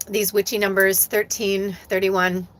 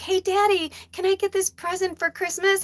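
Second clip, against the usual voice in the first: put-on much higher-pitched little boy voice; 12 semitones higher than usual. Little boy asking Daddy for a Christmas present, high child voice. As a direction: imitate a small boy pleading with his dad. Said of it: "when he was a little boy".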